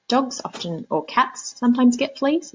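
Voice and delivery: plain reading voice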